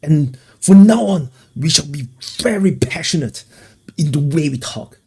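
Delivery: impassioned campaign speaker parody; passionate orator. Pretend. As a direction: ham up a rally speech. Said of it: "Maybe I should speak like this".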